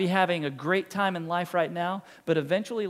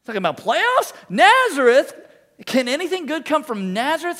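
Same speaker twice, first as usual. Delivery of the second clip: incredulous press-conference rant, higher and louder; Jim Mora rant; 6 semitones higher, 6 dB louder than usual. Retold or imitated. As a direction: rant in disbelief like the coach. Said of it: "Sounds like Jim Mora, playoffs".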